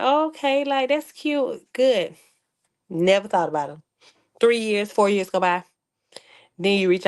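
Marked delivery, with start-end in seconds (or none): 0.0-2.2 higher, slow mock sweetness; mock sweet. Mocking sweetly about his wedding news. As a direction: brush it off with fake sweetness.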